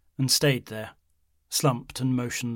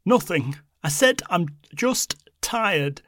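defiant, voice breaking; insist, fighting back tears; protesting; 7 semitones higher, 6 dB louder, slower than usual. A narrator's reading. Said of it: "Christine protested".

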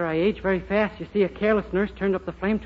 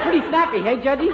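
ordinary talk

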